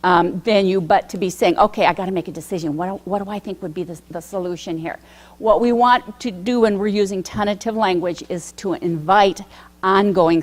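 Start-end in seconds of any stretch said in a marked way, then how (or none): 1.6-5.0 rushed pressured thinking. Retold or imitated. Teacher pressured to decide now.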